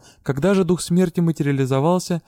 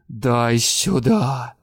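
very slow, low hissed demand; 3 semitones lower, much slower than usual. A narrator's reading. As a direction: hiss it like a menacing spirit. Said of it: "прошипела Дара". (прошипела Дара)

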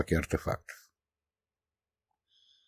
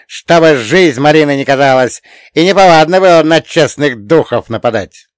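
put-on loud, rousing old man's urging; egging on; 5 semitones higher, 7 dB louder than usual. A narrator's reading. Old man goading the wizard to attack, loud egging on. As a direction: goad him loudly into striking. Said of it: "продолжал подзуживать меня старикан".

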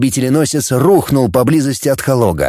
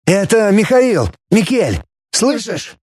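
excited voice over a radio; sci fi communicator; 8 semitones higher than usual. A narrator's reading. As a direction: call out through a crackly communicator. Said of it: "раздалось из коммуникатора".

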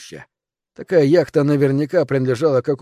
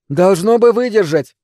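higher, louder call from a deck; 7 semitones higher, 6 dB louder than usual. A narrator's reading. Girl on deck to helpers on shore, calling out. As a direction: call it across to the pier. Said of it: "крикнула с палубы Герлиц".

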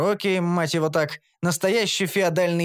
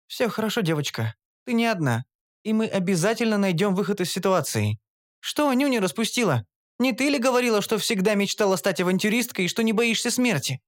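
gentle comfort; 7 semitones higher than usual. A narrator's reading. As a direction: soothe the scared girl gently. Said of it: "мягко обняла". (мягко утешает гномку, высоким голосом)

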